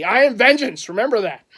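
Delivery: high, loud hero declaration; mock threat. Bidder warning eBay vultures off his jersey, mock threat. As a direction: warn them like a hammed-up avenger. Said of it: "If someone takes it from me".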